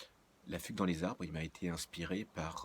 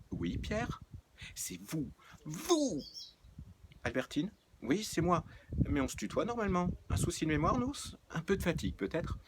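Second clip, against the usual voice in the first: high, loud sing-song teasing; sweetly provoke him; mock-innocent needling; cheeky innocence; 11 semitones higher, 3 dB louder than usual.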